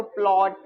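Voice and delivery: "प्लॉट" with an open aw vowel; open O reading. Shown as a demonstration. Teacher trying readings one by one, "प्लॉट" with open aw. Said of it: "पहले अ करके पढ़ो".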